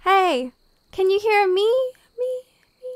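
shouting into a pit with a voiced echo; echo effect. Player redoing a self-made echo down the hole. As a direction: call down, then echo your own last word. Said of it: "Here, again, again".

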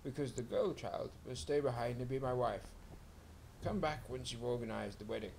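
lightly voiced scheming rat; the rat laying down its terms. A narrator's reading. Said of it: "said the big brown rat".

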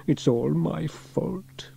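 sorry, sluggish character voice; moaning. A narrator's reading. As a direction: moan it full of self-blame. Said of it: "moaned Elvis".

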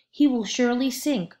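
plain reading voice

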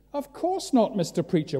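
high-pitched bystander voice; reply like a nervous townsman; lightly voiced crowd member; crowd answer.

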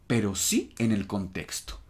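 ordinary talk

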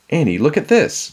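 high, loud, excited call. A narrator's reading. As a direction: call to her across a distance. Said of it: "Jack called".

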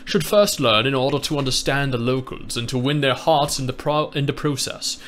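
measured, refined British reading; sophisticated British accent. Shown as a demonstration. Reading to a friend after promising better, sophisticated British accent. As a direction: read it like a cultured Brit. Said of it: "a sophisticated British accent now".

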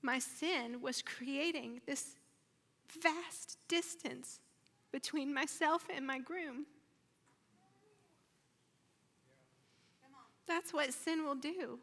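high, choked-up voice fading to a whisper; on the verge of crying; real tearfulness. Real, not acted. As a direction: speak slowly while holding back tears. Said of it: "I'm gonna cry again".

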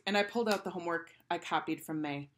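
plain reading voice

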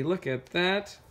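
ordinary delivery

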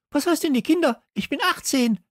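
hoch und empört protestieren. A narrator's reading. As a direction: sich gekränkt wehren. (empört und sehr hoch)

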